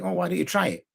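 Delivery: a real open invitation to try; honestly wondering if they'd try it; inquisitive. Shown as a demonstration. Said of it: "in an inquisitive way".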